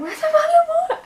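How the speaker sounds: very high, loud and fast with a laugh; panicked shriek. Retold or imitated. Vlogger reliving losing her water bottle, high panicked laughing shriek. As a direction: squeal it in sudden panic. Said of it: "I just looked down and I went".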